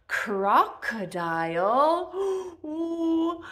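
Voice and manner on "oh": mock scared. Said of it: "I'm so scared about the crocodile".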